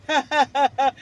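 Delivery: short loud gloating laugh. Real, not acted. Mocking the tenant with a gloating laugh. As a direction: laugh at him in triumph.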